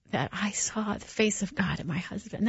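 hushed, breathy with feeling; near-whispered, choked with awe; emotional whisper. Real, not acted. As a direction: let it fall to a moved whisper. Moved memory of husband's love.

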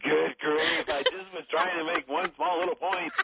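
high, amused protest; mock exasperation. Retells own exasperation, amid laughter.

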